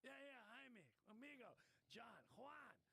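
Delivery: put-on high-pitched Spanish-accented voice; Spanish-accented imitation. Rescued man thanking his helpers, high Spanish-accented imitation.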